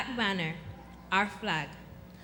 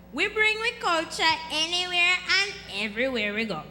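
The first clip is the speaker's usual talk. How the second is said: higher, louder, emphatic proclamation; grandmother's proud saying; 7 semitones higher, 5 dB louder than usual. Retold or imitated. Contestant quoting grandma to the audience, emphatic and proud. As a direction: quote grandma with punchy Jamaican force. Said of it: "As my grandma Gloria always says".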